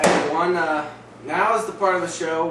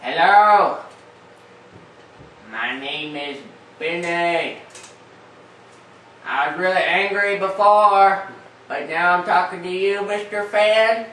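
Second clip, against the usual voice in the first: alien voice through fan; 5 semitones higher, 9 dB louder than usual. Acted. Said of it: "it'll sound like an alien voice".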